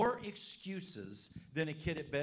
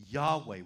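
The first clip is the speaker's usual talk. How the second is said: Yahweh said louder, set apart after a pause; stressed name; 3 semitones lower, 9 dB louder than usual. Shown as a demonstration. Pastor stressing Yahweh to the congregation, louder. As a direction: land on Yahweh. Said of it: "he chose the word".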